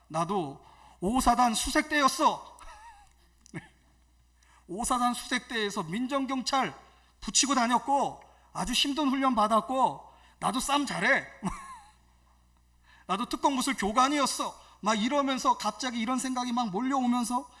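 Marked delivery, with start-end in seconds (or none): 0.1-11.2 mock angry boast. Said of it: "확 화가 치밀어 오르는데". 13.1-14.6 mock angry boast. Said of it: "확 화가 치밀어 오르는데".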